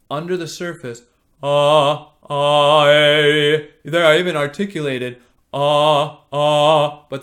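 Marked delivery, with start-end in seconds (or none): 1.4-7.0 loud but loose, unfocused vowels; loose vibration. Shown as a demonstration. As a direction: sing vowels with slack vibration. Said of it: "the vibration was still, uh, loose".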